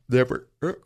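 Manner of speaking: words stretched through a yawn. Real, not acted. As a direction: say it mid-yawn. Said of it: "Sorry, I yawned".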